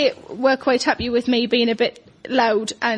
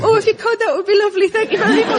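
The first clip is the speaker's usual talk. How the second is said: high, louder, gushing politeness; over-the-top sweet thanks, much higher; sudden sweetness; 8 semitones higher, 4 dB louder, faster than usual. Retold or imitated. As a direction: switch instantly to grateful charm. Sudden sweetness to lorry driver.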